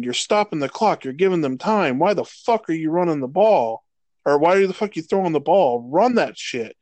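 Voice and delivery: normal talking voice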